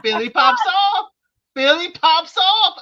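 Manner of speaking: loud, high "Philly pops off" with punch on off; Philly hype. Shown as a demonstration. Hyping Philly before the polite version, loud and high. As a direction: belt it like a hometown cheer. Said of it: "this is the very polite way of me saying".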